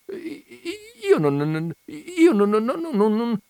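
high, broken stammer. Acted. The clerk stammering helplessly at Veneranda, high and broken. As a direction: choke on every syllable. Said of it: "balbettò il commesso".

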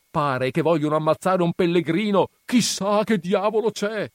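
very high, loud alarm; out-of-breath neighbour shouting the alarm; breathless shout. Acted. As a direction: rush in with urgent news. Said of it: "gridò".

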